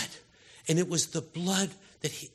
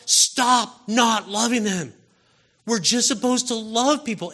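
forceful preaching; 6 semitones higher, 9 dB louder than usual. Real, not acted. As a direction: drive it home. Preacher exhorting congregation.

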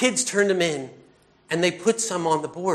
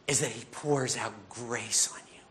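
breathy unvoiced whisper about grace; 6 semitones lower, 8 dB quieter than usual. Real, not acted. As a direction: drop to a hushed whisper.

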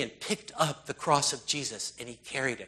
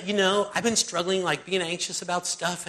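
normal talking voice

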